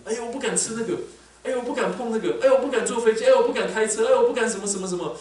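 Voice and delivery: high, loud mock complaining; mock fearful whining. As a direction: whine like a frightened worrier, hammed-up. Mocks fearful people's complaints. (夸张地模仿胆小的人抱怨)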